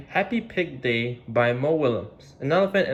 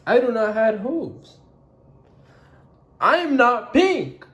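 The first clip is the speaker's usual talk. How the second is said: upset; 10 semitones higher, 5 dB louder than usual. Acted. Sad Gerald's list.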